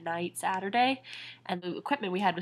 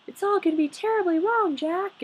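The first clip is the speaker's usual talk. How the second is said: high-pitched mocking imitation of a fretting character; Sally; 11 semitones higher, 4 dB louder than usual. Annoyed fan imitating Sally warning Jack, high mocking voice. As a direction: mimic her doom-saying in a high, loud whine. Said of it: "Like, she's like".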